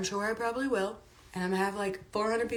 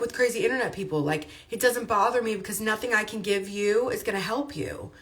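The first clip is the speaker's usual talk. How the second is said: ordinary talk, nothing marked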